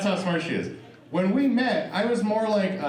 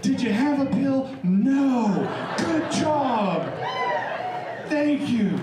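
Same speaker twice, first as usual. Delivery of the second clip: cheering section; 11 semitones higher, slower than usual. Retold or imitated. Comic cheering his sex life on stage, mock crowd cheer. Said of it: "I need a cheering section".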